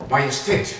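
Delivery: urgent call to the king. Acted. Courtier rushing in to the king. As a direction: announce yourself urgently.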